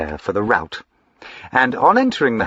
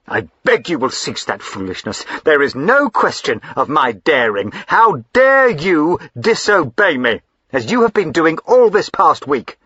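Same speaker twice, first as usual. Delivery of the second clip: stern rebuke; 5 semitones higher, 4 dB louder than usual. Acted. Husband rebuking wife.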